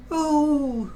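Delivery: very high groaning oh; a high carriage groan; coaches groan. A narrator's reading. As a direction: let the carriages moan. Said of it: "groaned the coaches".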